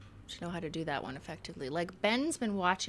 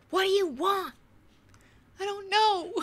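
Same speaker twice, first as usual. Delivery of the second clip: high, loud, hammed-up nagging; mock exasperated shout; 14 semitones higher, 7 dB louder than usual. Replaying the dinner question with Ben, in a mock exasperated shout. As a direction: throw the question back, half-yelling.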